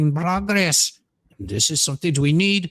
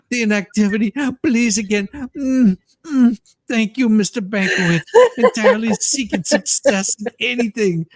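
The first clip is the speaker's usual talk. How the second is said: hammed-up pleading; 6 semitones higher, 4 dB louder than usual. Hammed-up breathless pleading with laughter.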